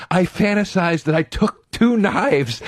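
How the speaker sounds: high, slow, seething imitation; imitating a friend getting worked up. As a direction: draw it out high, savouring the fantasy. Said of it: "he would get so worked up".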